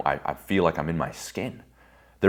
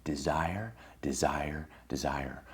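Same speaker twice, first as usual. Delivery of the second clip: fading voiceless whisper, softer each time; whispered desire; 3 semitones lower, 11 dB quieter, slower than usual. Shown as a demonstration. Speaker reveals what was in the man's eyes, fading whisper. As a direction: breathe the word out, dying away. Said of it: "what was in his eyes?".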